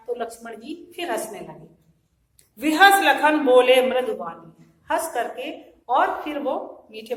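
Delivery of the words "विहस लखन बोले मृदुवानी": slow, loud verse declamation; verse recitation. Shown as a demonstration. Teacher repeating verse on Lakshman's reply, slow loud recitation. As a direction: stretch the line out loudly.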